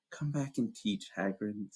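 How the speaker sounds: soft, slow, pleading; softly, slowly beg him to return; quiet plea. Acted. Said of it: "said Hermione quietly".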